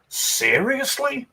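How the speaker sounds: drawn-out, high disbelieving "seriously"; incredulous. Retold or imitated. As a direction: stretch it out in total disbelief. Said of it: "and I'm like".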